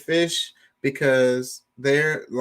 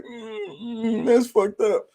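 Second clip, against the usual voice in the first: sad dog voice; 9 semitones higher, slower than usual. Retold or imitated. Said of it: "The dog gonna be all sad".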